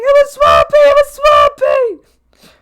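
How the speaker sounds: high, loud, slow yelling brother; imitating brother. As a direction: shriek it like a furious sibling.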